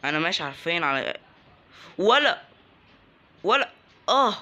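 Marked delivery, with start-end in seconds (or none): 2.0-4.3 startled yelps. Real, not acted. Ambushed by enemies in game.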